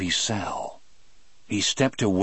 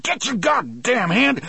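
high, loud angry outburst; alarmed shout; 4 dB louder than usual. A narrator's reading. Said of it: "I cried".

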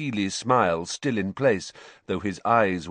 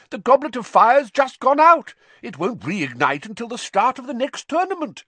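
very high, loud explanation; protesting official; 7 dB louder than usual. Acted. Bagman telling Karkaroff the Goblet is out, protesting. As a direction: argue back in a raised pitch.

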